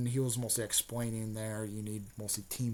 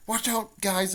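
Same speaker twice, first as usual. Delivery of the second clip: higher, louder mock warning cry; pitched-up alarmed girl; Hinata warning; 9 semitones higher, 6 dB louder, slower than usual. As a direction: call out a warning in a girl's voice. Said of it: "She's like".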